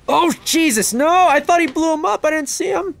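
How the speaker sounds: very high, loud panicked yelling; alarmed shouting. Real, not acted. Player caught out by an enemy, high alarmed shouting. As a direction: yell in startled disbelief.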